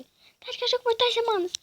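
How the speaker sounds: high, loud mock mother; hammed-up nagging mom; imitating mom. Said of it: "eu só ia falar assim com você".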